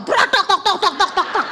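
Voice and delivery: high rattling run of knocks; sharp crack, then rapid clatter; imitated clattering car part. Exhaust falling off halfway up, imitated clatter.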